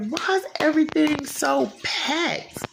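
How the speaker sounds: high, dragged-out exasperated complaint; irritated attitude. Retold or imitated. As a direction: whine it slowly with attitude. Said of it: "I was so irritated and had an attitude".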